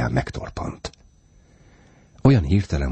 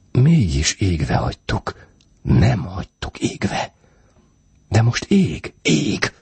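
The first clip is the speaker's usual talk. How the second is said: higher, emphatic back-and-forth; tense insistence; 6 semitones higher, slower than usual. A narrator's reading. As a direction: argue it urgently, pitch climbing. Frightened couple arguing over the light. (feszülten, emelkedő hangon vitázva)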